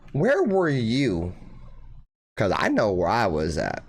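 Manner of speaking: ordinary talk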